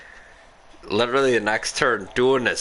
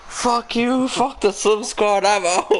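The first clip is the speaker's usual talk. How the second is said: much higher, hammed-up outburst; mock rage quit; 12 semitones higher, 3 dB louder than usual. Imitating a racer quitting the squad, mock rage. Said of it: "So he just rage quits".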